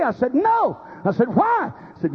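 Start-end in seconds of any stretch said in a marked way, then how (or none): 0.3-0.8 high, drawn-up protesting no; retold emphatic "no", pitched way up; emphatic denial. Preacher retells answering a lady who asked if he's mad, high emphatic denial. 1.3-1.7 high, astonished retort; retold surprise, pitched way up; astonished question. Preacher retells asking a lady why she'd think that, high astonished question.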